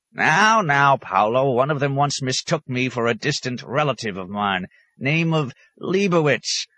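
high, animated hermit voice; crotchety high-pitched storyteller; placating old hermit. A narrator's reading. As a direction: protest and explain yourself to a friend.

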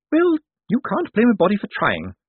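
put-on high-pitched old lady voice; Topsy Lavish. A narrator's reading. Dead woman excuses herself to Death, high and pert.

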